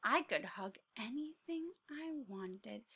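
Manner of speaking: dreamy unvoiced whisper. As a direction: breathe it like a wish. Tiny dreams aloud.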